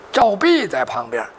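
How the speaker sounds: ordinary delivery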